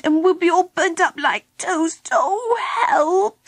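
high wail building to a squeal; small creature's despairing cry, climbing. A narrator's reading. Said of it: "he cried".

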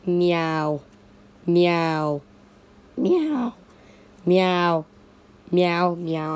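pretend cat. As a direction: meow insistently like a cat. Playing a cat meowing.

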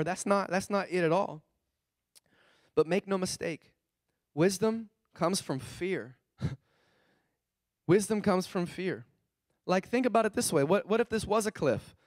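ordinary talk, nothing marked

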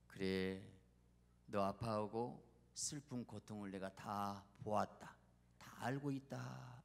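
slow, low, measured divine reassurance; God's voice. Acted. God answers a believer praying in pain, slow and consoling. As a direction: speak slowly as a consoling God. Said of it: "그럼 하나님 뭐라 한가?".

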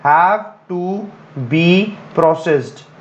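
"have to ... be ... processed" with pauses around "be"; word-by-word correction. Shown as a demonstration. Teacher dictates the correction, "have to / be / processed" in separated pieces. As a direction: set "be" apart, then land "processed" with a fall. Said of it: "पहली गलती आपकी यहां पर आ गई".

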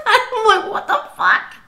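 breathy laugh through "What the fuck"; laughing disbelief. Retold or imitated. Retelling her husband's reply, laughing in disbelief.

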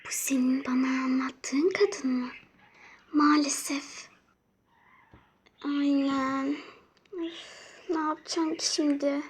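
whispered secret gossip; whispering. Pretend. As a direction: whisper so the guest won't hear. Talking secretly about the guest.

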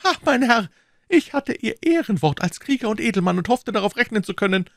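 high-pitched, wounded reproach; reproachful woman. A narrator's reading. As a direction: scold them for a broken promise. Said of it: "rief Madame Bonacieux im Tone des Vorwurfs".

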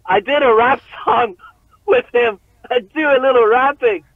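high, loud send-up of a geek; mock your own nervous fanboy brag. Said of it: "like a fucking geek, dude, all nervous".

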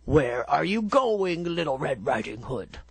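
low, put-on wolf voice; the wolf sweet-talking the girl, low; sly wolf. A narrator's reading. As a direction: greet her like a sly wolf.

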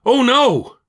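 high, loud alarmed cry. A narrator's reading. Elijah sees the lion behind him, crying out. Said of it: "Elijah cries out".

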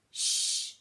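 loud unvoiced shushing hiss; dog's urgent shush; whispered shush. A narrator's reading. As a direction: hush Santa with a shh. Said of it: "whispered the family's dog".

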